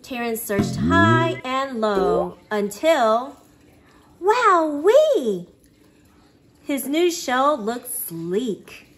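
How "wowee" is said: higher and louder, thrilled; excited wowee. Acted. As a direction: burst out with delight. Discovering new shell.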